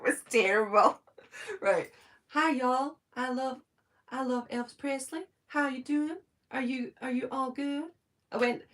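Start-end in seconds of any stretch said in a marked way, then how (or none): 2.3-7.8 higher-pitched American southern accent attempt; southern drawl. Shown as a demonstration. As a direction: put on a caricature southern drawl. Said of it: "going down a southern drawl".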